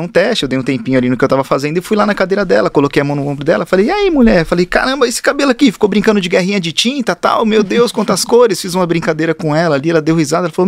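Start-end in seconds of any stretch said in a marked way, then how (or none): 3.9-8.6 high, bright teasing of a client, laughing at the end; playful teasing. Retold or imitated. Hairdresser joking with a new client, pitched-up teasing. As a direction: tease her warmly about the paint-war hair.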